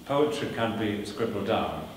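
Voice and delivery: ordinary talk, nothing marked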